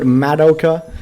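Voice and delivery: MADOKA drawn out as "MAD-oh-ka"; too bumpy. Shown as a demonstration. Streamer lists wrong versions they have heard, too bumpy. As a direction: lean on a flat English "mad" in "Madoka". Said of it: "way too bumpy".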